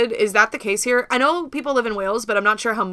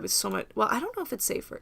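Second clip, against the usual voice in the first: ordinary delivery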